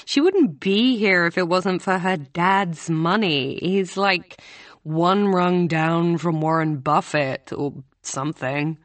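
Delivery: put-on voice of a dismissive student; gossiping student, scornful. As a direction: gossip disparagingly about a classmate.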